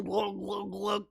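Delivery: mimicked gulping noise; imitated glugging. Host miming coffee straight to the dome, glugging. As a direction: make a throaty drinking sound.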